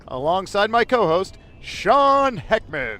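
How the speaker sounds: loud, high show-host announcing; announcer voice. Acted. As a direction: boom it out like a TV announcer. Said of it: "this is my announcer voice".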